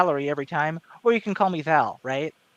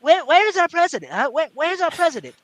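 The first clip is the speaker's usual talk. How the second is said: high, louder Trump, mock searching; Trump impression; 10 semitones higher, 4 dB louder than usual. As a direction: call out high like Trump hunting for someone. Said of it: "that's kinda how he talks".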